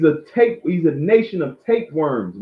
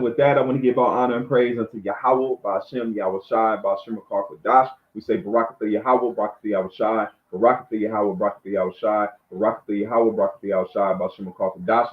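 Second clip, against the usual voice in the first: low, softer recited praise; closing blessing; 6 semitones lower, 4 dB quieter, faster than usual. Real, not acted. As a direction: recite the blessing evenly, pitched down.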